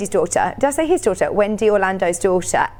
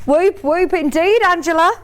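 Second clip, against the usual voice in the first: put-on Australian accent; 7 semitones higher than usual. Host cheering a viewer's purchase in a mock Australian accent. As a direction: slip into a playful Australian lilt, rising at the end. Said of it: "I don't know why I went Australian then".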